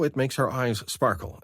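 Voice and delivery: ordinary delivery, nothing marked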